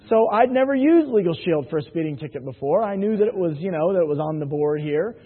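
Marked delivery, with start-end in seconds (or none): none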